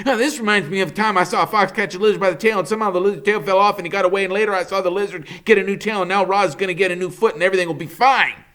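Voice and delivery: high, fast, breathless chatter; chattering squirrel. Acted. Chit Chat chattering down at the robot from a branch, fast and high. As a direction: rattle it off like an excited squirrel.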